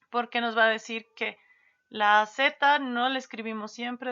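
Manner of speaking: ordinary delivery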